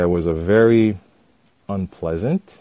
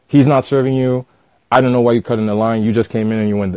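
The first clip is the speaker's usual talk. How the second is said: louder, higher mock rant; retold yelling; 3 semitones higher, 6 dB louder, faster than usual. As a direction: imitate the pushy guy getting worked up. Rude customer insists again.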